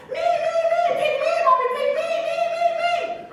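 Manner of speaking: put-on very high, loud begging of small children; eager kids begging. Eaglets begging Mommy to pick them, very high and eager. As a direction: squeal to be picked first.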